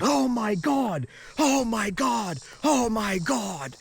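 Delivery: high, drawn-out cries of dismay; exasperated guard. Retold or imitated. Guard reacts to sand in the suitcase, exasperated. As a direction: imitate a guard's alarmed outburst, very slowly. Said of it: "threw his hands up and going".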